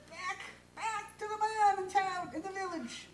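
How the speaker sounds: high, insistent wife; wife's voice. A narrator's reading. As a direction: send him off again. Wife sends him again.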